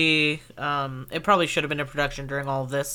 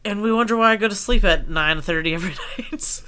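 laughing; 5 semitones higher, 5 dB louder than usual. Retold or imitated. Quoting herself, laughing.

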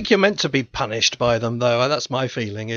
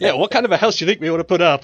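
quick, higher, eager Groucho quote; sudden enthusiasm; 4 semitones higher, 3 dB louder, much faster than usual. Retold or imitated. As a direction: imitate someone suddenly all for the idea. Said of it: "he's suddenly consumed with enthusiasm".

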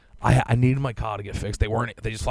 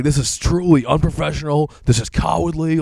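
higher and louder, righteously scolding; retold indignation; 5 semitones higher, 5 dB louder than usual. Scolding the dealership in an email, raised indignation. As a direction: deliver it as a scathing verdict. Quoting his angry emails.

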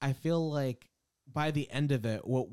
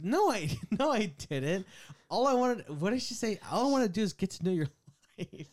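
mom voice; 7 semitones higher than usual. Retold or imitated.

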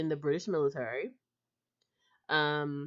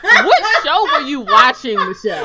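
loud, high, laughing disbelief; helpless laughter through the question; 8 semitones higher, 16 dB louder than usual. Real, not acted. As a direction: crack up while teasing a friend. Host cracks up at co-host's lapse.

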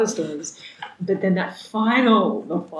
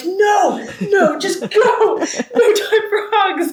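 much higher, louder, slow and laughing; urgent mock shout; 13 semitones higher, 6 dB louder, much slower than usual. [name] stopping the hug at the airport, urgent laughing shout. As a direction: hurry them along, half laughing.

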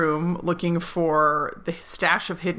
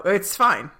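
ordinary delivery

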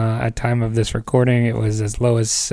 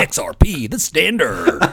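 radio announcer; 3 semitones higher than usual. Pretend. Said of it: "like a radio station".

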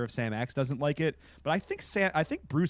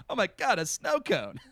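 higher, delighted put-on voice; Sam Axe impression; 7 semitones higher than usual. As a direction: gush over the snow cone in a mock voice. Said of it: "he's like".

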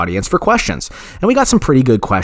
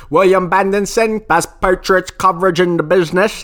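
mock announcer intro; 8 semitones higher than usual. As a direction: sell him like a radio promo. Hyped intro of podcaster.

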